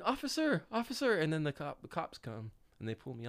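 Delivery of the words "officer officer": high-pitched cry for the officer; imitating a panicked cellmate calling; mock scream for help. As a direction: call out high, not very loud. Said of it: "then he starts screaming".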